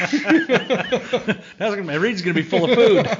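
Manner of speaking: high laughing joke. Real, not acted. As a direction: laugh through the words. Joking while laughing.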